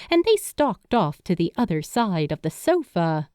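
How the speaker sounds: ordinary delivery, nothing marked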